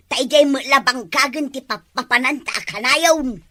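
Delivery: usual speaking voice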